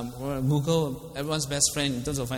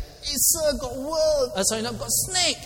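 retold shout; 9 semitones higher than usual. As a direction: yell it out like you've just spotted a snake in the jungle. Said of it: "Then suddenly I shout".

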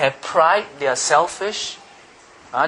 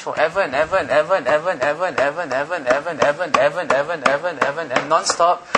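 fast breathless repetition of ever; rattling on; 2 semitones lower, faster than usual. Shown as a demonstration. Preacher shows forever, fast and breathless. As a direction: rush the and evers together. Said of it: "I need to catch my breath".

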